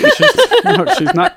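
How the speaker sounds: high giggly words; laughing while talking. Real, not acted. Teasing, both cracking up.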